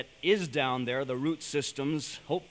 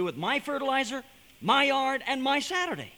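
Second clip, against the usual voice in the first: "my" punched a bit higher on each item; stressed my; 8 semitones higher than usual. Retold or imitated. Preacher mocking his own indignation, stressing every "my". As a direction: hammer "my" in "my yard, and my Saturday".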